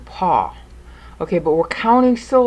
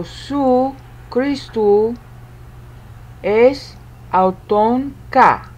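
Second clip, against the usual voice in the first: slow Greek recitation; 3 semitones higher, slower than usual. Shown as a demonstration. Reads out matched Greek syllables.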